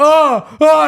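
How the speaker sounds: high, loud panicked wails; mimicked distressed shrieking; full-stress cries. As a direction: cry out in helpless alarm. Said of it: "he's, like, full stressing".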